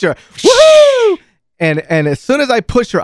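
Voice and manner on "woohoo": loud, very high held whoop; high whooping cheer. Retold or imitated. Pushing the girl off down the line, high whooping cheer. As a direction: whoop like someone flying down a zip line. Imitates the zip line launch.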